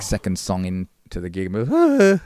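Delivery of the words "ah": a strained high yelp that breaks off; mock voice cracking. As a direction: cry out a note that cracks and dies. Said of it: "and you're cutting out".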